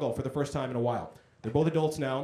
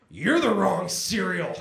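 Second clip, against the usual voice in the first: mock drunk comeback; 3 semitones higher, 4 dB louder, slower than usual. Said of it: "And so he goes".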